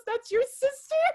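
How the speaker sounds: high, loud-ish retold gotcha; mock shocked accusation. Girlfriend to boyfriend after he falls for the trap, mock shock. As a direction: imitate a girlfriend pouncing in disbelief.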